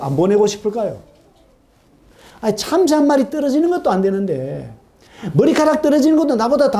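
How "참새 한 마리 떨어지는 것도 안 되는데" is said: ordinary delivery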